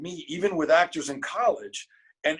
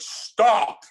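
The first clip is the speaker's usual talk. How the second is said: big, higher, louder bellow; bellowing professor; 7 semitones higher, 7 dB louder than usual. Retold or imitated. Acting professor cutting off a student's monologue, bellowing. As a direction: bellow it to halt the audition. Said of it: "big bellowing voice".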